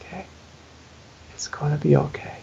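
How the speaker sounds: very soft, soothing murmur; quiet comfort to the child within; hushed reassurance. Retold or imitated. He holds his panicking inner kid, hushed reassurance.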